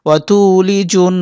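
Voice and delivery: slow, humming the final n of "তুলিজুন"; ghunnah. Shown as a demonstration. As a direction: draw out the closing n in "তুলিজুন". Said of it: "গুণনা করতে হবে". ("তুলিজুন"-এর শেষ নুনে গুন্নাহ)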